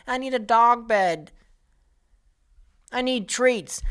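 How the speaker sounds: high, slow, whiny guest voice; mock the entitled dog owner asking front desk; whiny mock guest request; needy guest. Mocking needy hotel guest.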